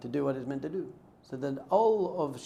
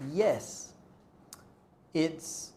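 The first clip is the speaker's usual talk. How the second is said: ordinary talk, nothing marked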